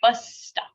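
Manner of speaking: "Bus stop" as one unit with a long s; held consonant. Shown as a demonstration. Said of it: "You hold it".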